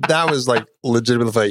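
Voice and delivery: usual speaking voice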